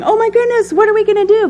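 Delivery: high, loud mock panic; frantic mock cry of alarm. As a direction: play the panicked disciple, frantic. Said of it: "just start frantically going".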